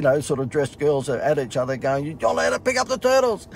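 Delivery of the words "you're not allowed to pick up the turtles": high, prim rebuke; a bossy ranger telling off; scolding guide. Retold or imitated. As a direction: guide snaps at the other, high-pitched. Guides arguing.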